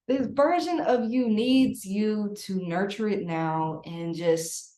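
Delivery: normal talking voice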